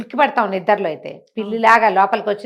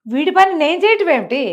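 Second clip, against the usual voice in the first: mock outrage, higher and louder; indignant ghost; 7 semitones higher, 4 dB louder than usual. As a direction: spit it out, offended at doing his chores. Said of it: "కొళ్ళు మండిపోయింది". (కోపంగా, ఎత్తైన గొంతుతో)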